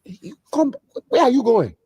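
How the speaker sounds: high, stern Nigerian dad voice; Nigerian father. Retold or imitated. Father stopping his son leaving, sternly. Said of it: "He said".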